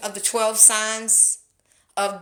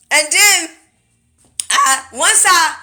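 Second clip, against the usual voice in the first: ordinary talk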